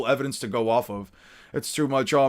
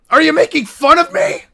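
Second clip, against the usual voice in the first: much higher, louder hurt whine; whiny character voice; 11 semitones higher, 9 dB louder than usual. Acted. Friend teased by Rocket Bro, high whine. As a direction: play the hurt, protesting pirate.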